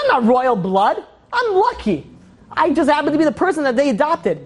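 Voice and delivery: high-pitched, incredulous protest; distraught realization. Retold or imitated. Adopted king alone by lake, distraught realization. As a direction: protest it in rising disbelief.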